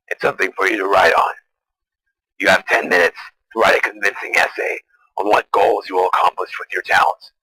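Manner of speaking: ordinary talk, nothing marked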